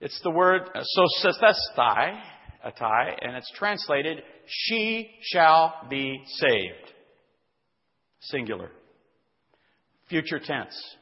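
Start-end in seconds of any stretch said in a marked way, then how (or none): 4.4-6.9 "She shall be saved" drawn out very slowly; emphatic stress. Shown as a demonstration. Preacher showing the word placed first for emphasis, "She shall be saved" slow and emphatic. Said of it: "that's for emphasis".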